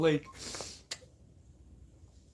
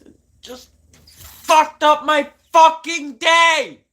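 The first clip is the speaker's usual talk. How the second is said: real anguished yell, much higher and louder; furious shout; 14 dB louder, slower than usual. Real, not acted. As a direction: scream out the frustration, slow and raw. Frustrated rant about his dad.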